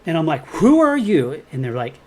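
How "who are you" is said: higher and louder, clearly pissed off; retold angry challenge to a threatening caller; angry demand. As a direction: snap the question at a stranger. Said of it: "And then I'm getting pissed".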